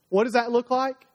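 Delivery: ordinary talk, nothing marked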